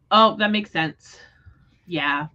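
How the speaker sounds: normal talking voice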